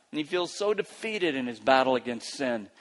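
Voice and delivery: ordinary delivery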